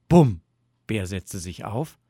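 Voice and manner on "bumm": sudden booming thump; loud knock. A narrator's reading. Knocking startles sleepy Bear, loud thump. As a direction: hit it hard and high, like a fist on a door.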